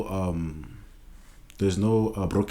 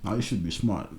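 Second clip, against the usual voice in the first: ordinary talk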